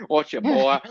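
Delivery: drawled cowboy line. Retold or imitated. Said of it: "It's sort of like a cowboy".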